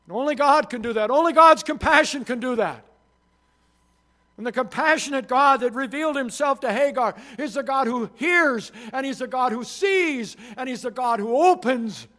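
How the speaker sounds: loud, high, ringing preacher's voice; full-force preaching. Acted. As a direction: lift it up and proclaim to the congregation.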